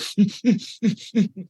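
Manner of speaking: put-on high, wailing cry of a heartbroken kid; a quick imitation of a child sobbing; imitated child's wail. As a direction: mimic the boy's sob as he breaks down. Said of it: "like that horrible cry".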